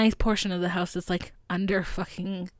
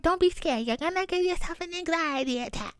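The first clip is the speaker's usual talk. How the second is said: high-pitched dismissive mimicry; lightly mocking; 9 semitones higher than usual. Mother dismisses her frightened daughter, lightly mocking. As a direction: brush it off in a high, teasing voice. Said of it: "she's mocking me".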